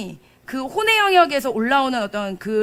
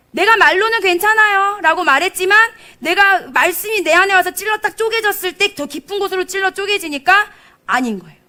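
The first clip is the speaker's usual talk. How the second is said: loud, high, driving sermon voice; fervent preaching; 7 semitones higher, 7 dB louder than usual. Acted. Preacher urging the congregation about the living word. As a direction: proclaim it with full force.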